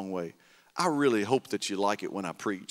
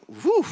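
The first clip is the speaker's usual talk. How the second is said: joyful exhale; 7 dB louder than usual. Real, not acted. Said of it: "it just felt like".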